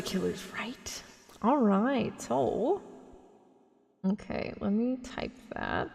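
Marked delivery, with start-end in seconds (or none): 0.0-1.0 breathy whispered aside; whispering. Real, not acted. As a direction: drop to a whisper.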